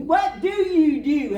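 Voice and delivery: loud, high, slow disbelief; disgusted exclamation. Retold or imitated. Local man disgusted with the newcomer, loud slow disbelief. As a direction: throw up your hands in disgust.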